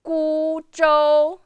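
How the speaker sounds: GU and ZHOU high, level and long; flat first tone. Shown as a demonstration. Teacher reading the words back, high sustained level tones. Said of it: "So keep the sound flat".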